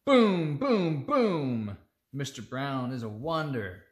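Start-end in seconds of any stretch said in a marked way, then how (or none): 0.0-1.8 loud booms; booming. Pretend. Parent reading, loud thunder booms. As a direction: boom like thunder. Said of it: "He can sound like a hand on the door".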